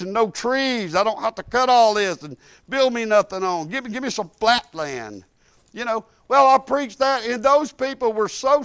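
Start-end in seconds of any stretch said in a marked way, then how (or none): none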